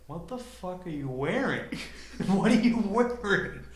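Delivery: loud disbelieving shout; shocked, rising disbelief; incredulous. Retold or imitated. Incredulous reaction to outfit.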